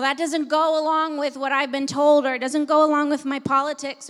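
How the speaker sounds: loud, driving preacher's cadence; preaching at full force. Acted. Preacher driving truth home to the congregation, forceful.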